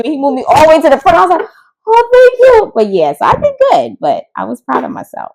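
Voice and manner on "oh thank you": high, loud, gushing thank you; mock grateful gush; relieved thanks.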